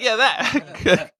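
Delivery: laughing imitation. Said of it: "He's like".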